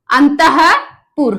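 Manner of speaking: अंतःपुर said slowly with a breathy ह for the visarga, no स; अंतःपुर, each syllable stretched, अं-तः-पुर; slow correct form. Shown as a demonstration. Teacher corrects a common spelling error, slowly. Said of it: "अंतस्पुर नहीं".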